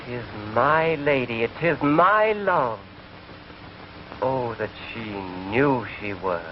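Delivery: slow, hushed dramatic verse reading; Shakespeare recital. Acted. [name] reciting Romeo's balcony speech, hammed-up verse. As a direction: declaim Romeo's lines softly. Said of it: "It goes".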